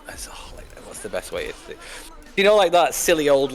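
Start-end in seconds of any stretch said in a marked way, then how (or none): 0.1-1.8 whispering. Real, not acted. Aside while searching for example.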